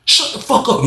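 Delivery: a cellmate snapping over the noise, yelling; angry yelling. Retold or imitated. Inmate yelling at a noisy cellmate, high angry shout. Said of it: "All you heard was".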